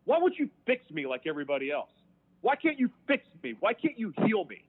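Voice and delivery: high, pleading angry demands; pissed off. Retold or imitated. Desperate inmate demanding that God heal him, pissed off. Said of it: "I get pissed off. And I'm like".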